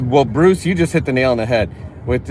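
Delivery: normal talking voice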